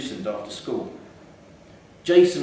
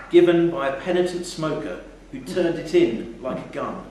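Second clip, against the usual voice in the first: ordinary delivery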